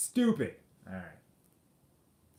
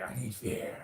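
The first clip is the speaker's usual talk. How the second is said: a hint of a creepy villain drawing out each need, the last line very slow; Scarecrow villain voice; 2 semitones lower, 4 dB quieter than usual. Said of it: "The Scarecrow, right? It's like".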